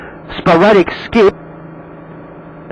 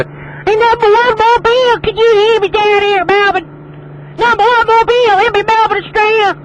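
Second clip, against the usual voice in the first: much higher mock voice of a far-off caller; imitating Melbourne callers. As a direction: play the distant Aussie boys calling in, pitched way up. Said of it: "give all the boys in Melbourne a chance to go".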